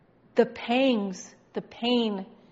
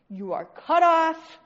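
loud, drawn-out, higher declaration; emphatic pronouncement; 4 semitones higher, 9 dB louder, slower than usual. Acted. Preacher declaring the sinner cut off, loud and emphatic. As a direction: slam it out slowly on CUT OFF.

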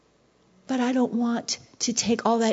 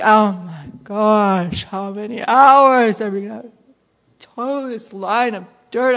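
weary field worker; 6 dB louder than usual. Retold or imitated. Field worker groans about labor.

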